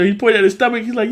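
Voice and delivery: usual speaking voice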